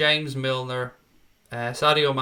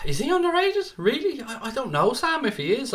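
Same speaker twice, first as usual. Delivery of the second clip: mock disbelief; 11 semitones higher than usual. Real, not acted. Incredulous reply to chat comment.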